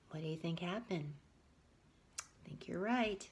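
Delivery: soft whispered reply. Real, not acted. Aside to listening child.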